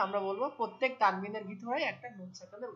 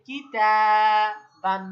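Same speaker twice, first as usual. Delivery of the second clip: "তা" held long and loud in "কি তা বান"; stretched syllable; 12 dB louder than usual. Shown as a demonstration. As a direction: draw out "তা", then land on "বান". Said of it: "তা কেন টান দিলাম".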